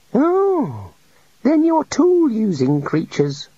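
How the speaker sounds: high, easing off, relieved. A narrator's reading. The god is reassured by the wizards, relieved. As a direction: let the worry go. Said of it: "relaxing a little".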